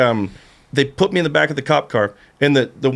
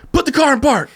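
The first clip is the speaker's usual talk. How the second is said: high-pitched mock-furious command; hammed-up irate order; imitating angrier guard; 11 semitones higher than usual. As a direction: mimic a guard losing his temper. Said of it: "he would get more mad".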